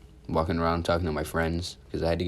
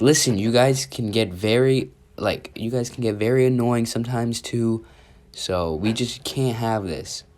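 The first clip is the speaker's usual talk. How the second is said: higher, louder exasperated scolding; frustrated teacher; 5 semitones higher, 5 dB louder than usual. Retold or imitated. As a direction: imitate a teacher mildly fed up with the class. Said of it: "the teacher was getting frustrated".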